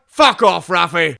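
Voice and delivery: loud, high outburst; captain losing it in a hammed-up quarrel; angry dismissal. Acted Picard and Raffi quarrel.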